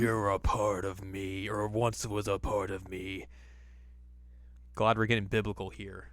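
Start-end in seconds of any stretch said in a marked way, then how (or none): 0.0-3.2 slow ominous villain line; sinister villain voice. Retold or imitated. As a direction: draw it out like a dark lord. Voicing Naraku slowly.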